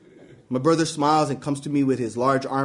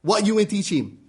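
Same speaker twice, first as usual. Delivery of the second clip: accusing dad; 5 semitones higher than usual. Acted. Dad presses the teacher.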